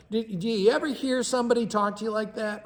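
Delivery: ordinary delivery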